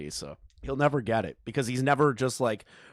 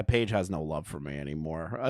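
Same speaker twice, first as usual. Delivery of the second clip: ordinary talk, nothing marked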